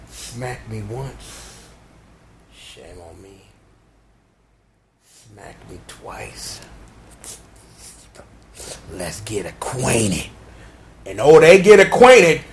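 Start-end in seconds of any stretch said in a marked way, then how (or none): 0.1-6.6 slow, low whispered taunt. Acted. Villain taunting the woman, slow and whispered. 8.9-10.3 low, slow whisper; menacing whisper. Acted. Villain whispering before the beating, slow and low.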